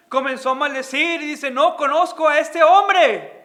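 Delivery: loud, driving sermon voice; full-force preaching. Acted. Pastor retells Peter's denials in a loud, preaching voice. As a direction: preach it out to the congregation.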